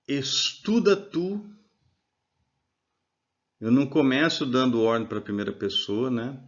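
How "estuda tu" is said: estuda tu drawn out slowly, rising on estuda; slow model phrase. Shown as a demonstration. Teacher modelling the imperative for students, slow and rising. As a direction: spell out estuda tu slowly for the class. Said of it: "eu dou ordem a partir da segunda pessoa".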